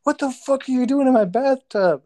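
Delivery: high, loud mock outrage; indignant lifeguard. Retelling a parody skit, high mock outrage. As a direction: squawk it like an offended lifeguard. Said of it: "he's like".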